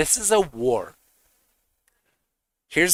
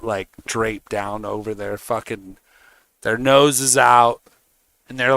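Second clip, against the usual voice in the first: ordinary talk, nothing marked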